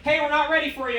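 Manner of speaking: lightly voiced worker butting in; curt drive-thru worker. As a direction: cut in from the speaker box. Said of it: "chime in and be like".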